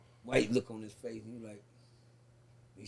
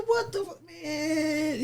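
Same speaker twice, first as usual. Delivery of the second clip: high-pitched disbelieving outburst; stunned dismay; 9 semitones higher, 4 dB quieter, much slower than usual. Retold or imitated. Defendant watching his girlfriend run out, high stunned dismay. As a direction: retell it as exasperated shock, without extra volume. Retold shock as girlfriend runs out.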